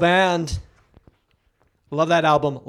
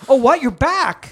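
higher, louder disbelief; mock shock; 6 semitones higher, 5 dB louder than usual. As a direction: play it stunned and outraged. Feigned shock at the news.

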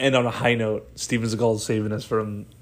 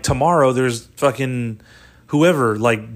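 ordinary delivery

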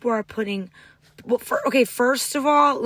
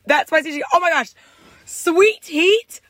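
louder, higher gushing over the sauce; excited gushing; 6 semitones higher, 6 dB louder than usual. Real, not acted. The host raving to her friend about Popeyes, excited and loud. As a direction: rave about it like a revelation.

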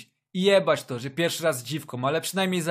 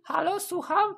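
high-pitched woman on the phone. Acted. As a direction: answer like a young woman.

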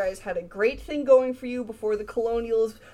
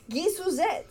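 higher, giggly Cajun name list; Cajun accent; 6 semitones higher than usual. Pretend. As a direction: Cajun names, laughing through. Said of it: "again, this is French".

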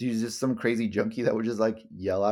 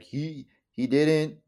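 halting, slow, higher-pitched bad impression; horrible Trump impression; 4 semitones higher, much slower than usual. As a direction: attempt a politician's voice and falter. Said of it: "I'm doing a horrible Trump impression".

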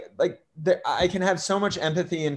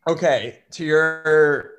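normal talking voice

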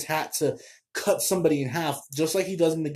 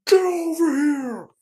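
much higher, loud, slowed battle shout; Scorpion's yell; 12 semitones higher, 5 dB louder than usual. Retold or imitated. Reviewer imitating Scorpion's catchphrase, a loud high yell. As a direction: bellow it like the ninja pulling his enemy in. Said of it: "he just yells".

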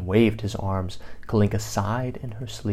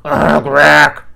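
loud grumbling beast groan; grumbled grack; 4 semitones higher, 10 dB louder than usual. A narrator's reading. Beast grumbling while the bird does his hair. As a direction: grumble it like an annoyed monster. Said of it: "grumbled Grackle".

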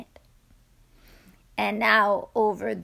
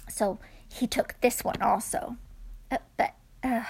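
ordinary delivery, nothing marked

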